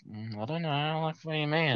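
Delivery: small, high, very soft voice; mock-timid, nearly tearful denial; about to cry. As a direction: play innocent in a tiny soft voice. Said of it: "I just about cried".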